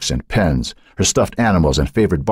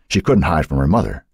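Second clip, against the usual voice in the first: ordinary delivery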